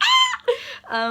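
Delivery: very high, loud shriek; fangirl scream. Retold or imitated. Fan seeing her idols answer, high fangirl scream. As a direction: scream like you're about to faint. Friend dead on the floor.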